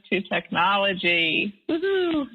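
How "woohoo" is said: sudden high woohoo of glee; excited cheer. Real, not acted. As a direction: cheer it out, celebrating a small victory. Cheering overcoming tech resistance.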